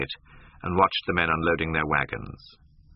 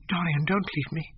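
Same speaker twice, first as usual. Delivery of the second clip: whispered plea; 12 semitones higher than usual. A narrator's reading. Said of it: "she whispered".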